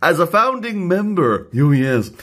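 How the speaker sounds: pompous reading of an important letter; very important messenger. Acted. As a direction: announce it like a momentous message. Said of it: "people that's been given a very important message".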